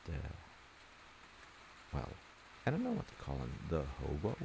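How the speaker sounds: plain reading voice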